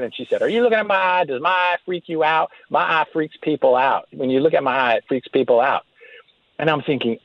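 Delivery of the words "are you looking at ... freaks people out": higher-pitched mock Southern wife, easing down as it goes; hammed-up Southern woman confronting someone; Southern accent, imitated wife. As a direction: blurt it out in an imitated Southern twang. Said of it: "caught me off guard".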